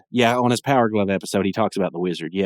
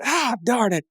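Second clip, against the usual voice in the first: much higher, jokey cry of disappointment; mock frustrated squeak; 13 semitones higher, slower than usual. [name] wrecking at the arcade, high squeaky darn it. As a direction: yelp it like a cartoon loser.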